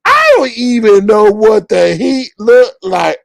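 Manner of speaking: loud, high mocking protest; Kimmy. Kimmy claims not to know the Heat, mocking denial. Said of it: "this is what Kimmy was lying".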